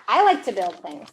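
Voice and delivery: ordinary delivery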